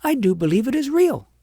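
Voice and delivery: put-on a child crying out that it is real; cry of conviction. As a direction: burst out with conviction. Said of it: "she gave a cry of conviction and said aloud".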